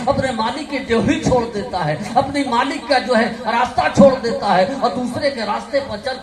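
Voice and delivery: loud, driving sermon climax; full-force preaching. Acted. Preacher exhorting the congregation, loud and driving. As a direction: hammer the lesson home to the crowd.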